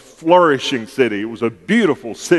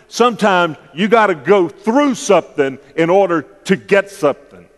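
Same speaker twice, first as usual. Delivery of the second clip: preacher voice; 4 semitones higher than usual. Real, not acted. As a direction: build it up like a preacher at the pulpit. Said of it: "I'm using my preacher voice on this one".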